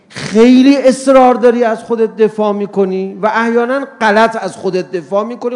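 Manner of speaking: ordinary delivery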